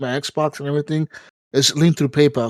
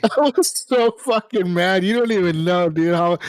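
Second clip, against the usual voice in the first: laughing; 2 semitones higher than usual. Real, not acted. Laughing, repeats how mad he was.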